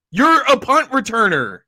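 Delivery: ordinary talk, nothing marked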